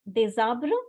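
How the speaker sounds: des arbres joined by a Z sound; liaison in des arbres. Shown as a demonstration. Teacher sums up the rule, des arbres linked. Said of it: "you have to make liaison".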